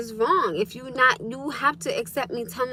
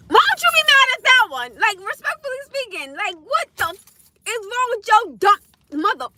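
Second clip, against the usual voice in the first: high, raised-voice exasperated rant; pissed off; 8 semitones higher, 4 dB louder than usual. Real, not acted. Venting what she wants to say to him, really pissed off. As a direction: tell them off at the top of your range. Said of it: "'Cause it be pissing me off".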